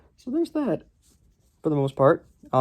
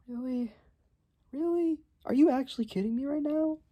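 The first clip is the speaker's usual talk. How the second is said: exasperated disbelief; 13 semitones higher, 10 dB quieter than usual. Real, not acted. Reviewer mocks cliché hero.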